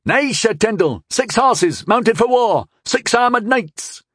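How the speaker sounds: high-pitched call from the watchtower; lookout calling down. A narrator's reading. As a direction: report it urgently from up on high. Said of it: "The watchtower called out".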